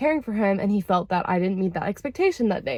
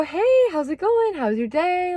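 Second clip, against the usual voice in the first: bright, much higher sing-song hello; cheery greeting; 9 semitones higher than usual. Retold or imitated. Coming home, greeting the sulking partner, high and cheery. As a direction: greet him sweetly, high and light.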